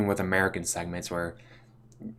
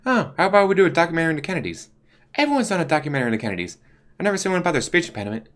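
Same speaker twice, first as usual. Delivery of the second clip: quick, higher eager pitch; clueless boss; 7 semitones higher, much faster than usual. Retold or imitated. The dim boss pitching a Kennedy documentary, fast and eager. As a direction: rattle it off like a proud idiot. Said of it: "he's like".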